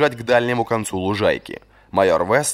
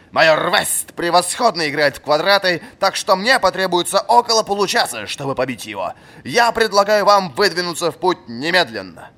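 high, loud commanding voice; stern old master; 9 semitones higher, 4 dB louder than usual. A narrator's reading. Old fencing master ordering a punishing run. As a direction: lay out the punishment with relish.

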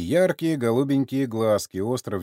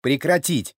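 loud, higher military command; sudden bark; 6 semitones higher, 6 dB louder than usual. A narrator's reading. Sergei abruptly silences the old man, barking. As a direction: bark it as an order. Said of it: "вдруг неожиданно рявкнул Сергей".

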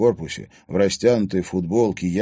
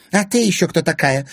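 high, loud, suspicious challenge; hostile; 11 semitones higher, 6 dB louder than usual. A narrator's reading. Zvereva confronting an unknown girl, hostile. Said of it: "прищурилась Зверева".

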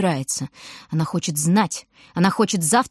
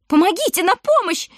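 much higher, louder panicked yell; wailing for help; 11 semitones higher, 4 dB louder than usual. A narrator's reading. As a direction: howl for help to the whole fair. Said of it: "вопит миссис Розенхаут".

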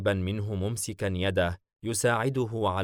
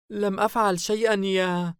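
high, louder frightened plea; 12 semitones higher, 5 dB louder than usual. A narrator's reading. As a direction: plead innocence in fear, cut off. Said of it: "وهي تتقدم خائفة". (توسل خائف بصوت عال)

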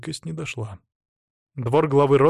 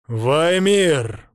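calling out; 3 semitones higher, 5 dB louder than usual. A narrator's reading. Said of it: "позвал он".

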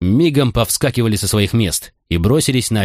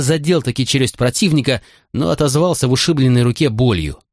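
ordinary delivery, nothing marked

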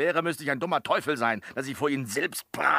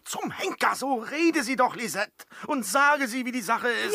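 exasperated demand; 10 semitones higher, 3 dB louder than usual. Acted. Servant demands Lisette speak up.

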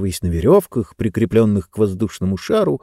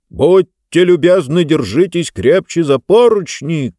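shouted; 6 semitones higher, 3 dB louder than usual. Acted. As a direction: call out over the engine. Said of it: "крикнул Джонс".

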